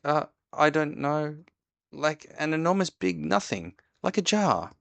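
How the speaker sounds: high, fumbling, frightened voice; a scared little pig struggling for words; flustered Piglet. A narrator's reading. Piglet describes the monster.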